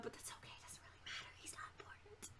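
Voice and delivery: deadpan whispered aside; hushed mock-callous whisper. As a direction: whisper it like a guilty secret. Whispered aside after losing child.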